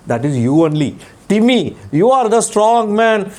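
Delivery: ordinary delivery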